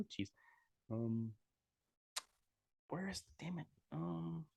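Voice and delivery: quiet frustrated mutter under breath; muttering to himself. Real, not acted. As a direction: grumble it quietly while searching. Searching online, muttering to himself.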